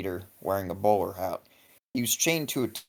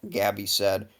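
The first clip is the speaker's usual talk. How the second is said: ordinary delivery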